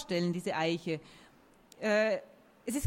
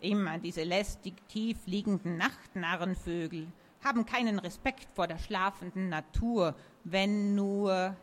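ordinary delivery

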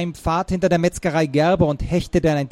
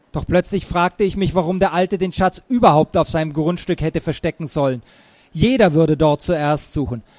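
usual speaking voice

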